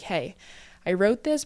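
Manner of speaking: ordinary talk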